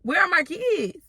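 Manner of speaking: near-shriek of panic; high desperate repeat; frantic high demand. Retold or imitated. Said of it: "I'm like".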